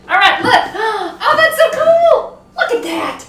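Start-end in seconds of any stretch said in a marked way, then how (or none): 1.2-3.2 thrilled. Real, not acted. Thrilled at the finished leaves.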